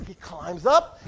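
ordinary talk, nothing marked

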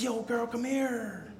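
a sly tempter calling the woman over; smooth-talking snake. Retold or imitated. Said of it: "And the snake says".

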